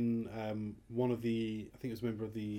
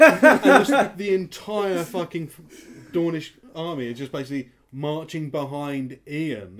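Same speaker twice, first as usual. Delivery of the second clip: high, loud, breaking into real laughter; laughing disbelief; 6 semitones higher, 15 dB louder, slower than usual. Real, not acted. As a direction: tell it while cracking up. Tellers cracking up at absurd sight.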